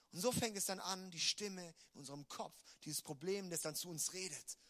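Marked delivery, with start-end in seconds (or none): none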